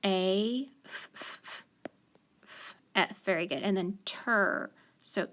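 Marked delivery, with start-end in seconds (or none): 0.8-2.8 "f" as soft voiceless puffs; isolated breath of "f", no vowel; F sound. Shown as a demonstration. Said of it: "What do you hear?". 4.0-4.7 "ter" slowly, as a separate syllable; isolated, drawn "ter"; second syllable alone. Shown as a demonstration. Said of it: "we're gonna break it apart".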